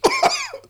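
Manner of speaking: strangled high cough; coughing from the burn; high choked cough. Real, not acted. After the shot.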